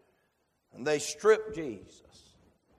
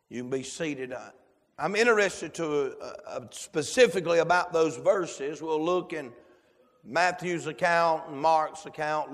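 ordinary delivery

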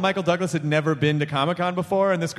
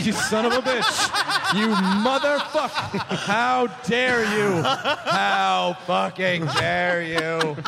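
slow, hammed-up indignation; mock outrage; much slower than usual. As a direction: drag out each word in pretend offence. Mock outrage at request for Chinese.

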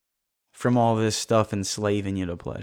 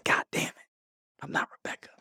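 inner whisper; 7 dB quieter than usual. Retold or imitated. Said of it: "And it's like".

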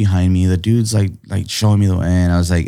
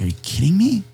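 excited disbelief; 10 semitones higher than usual. Retold or imitated. Thrilled by mural invitation.

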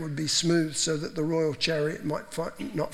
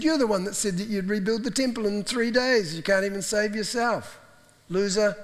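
mocking taunt; 5 semitones higher, 4 dB louder than usual. Mockers taunting Jesus on cross.

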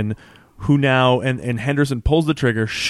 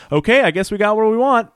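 put-on accent dropped; 8 semitones higher, 3 dB louder than usual. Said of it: "Pete drops the accent and goes".

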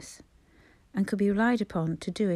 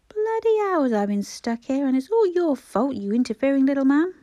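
loud, higher, bad-tempered complaint; grumbling angry man; 6 semitones higher, 6 dB louder than usual. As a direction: snap at him like a fed-up old patient. Grumpy patient blaming the doctor.

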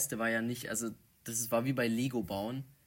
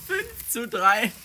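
laughing; 12 semitones higher, 12 dB louder, much slower than usual. Real, not acted. Counting another voice crack.

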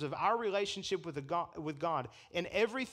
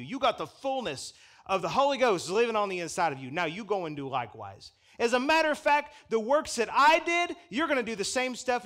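louder, higher fiery proclamation; full-force preaching; 3 semitones higher, 7 dB louder than usual. Acted. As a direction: preach it to the rafters.

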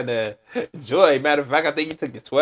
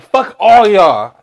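mock rival fan; 4 semitones higher, 8 dB louder, much slower than usual.

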